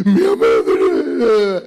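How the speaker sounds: slow, whiny, put-on weeping voice; wife fake-crying to her husband about his mother; imitating crying wife. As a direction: drag the words out like a hammed-up sob. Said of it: "ఆయన ఇంటికి రాగానే ఏడుస్తారు".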